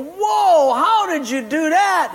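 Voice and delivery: high, loud astonished question; a loud, high amazed whoa. Retold or imitated. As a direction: gasp it out high in wonder. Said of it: "the other two guys go".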